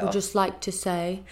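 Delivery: put-on slow, botched English accent gone Cockney; accidental Cockney, drawn out. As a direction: try for Queen's English and land in Cockney. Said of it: "it got even more Cockney".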